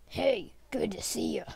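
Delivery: put-on breathy old grandpa whisper; grandpa penguin whisper. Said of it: "Grandpa Penguin and Baby Hulk".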